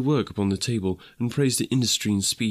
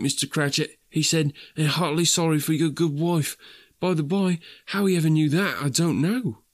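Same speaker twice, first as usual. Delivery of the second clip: higher, earnest storytelling in character; lightly voiced Bob, grateful father; 6 semitones higher than usual. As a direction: recount a kindness with gentle enthusiasm.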